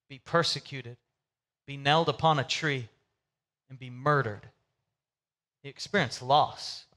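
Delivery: a pastor slowing down over grief; somber sermon. Acted. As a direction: let the weight of loss settle. Somber preaching on loss.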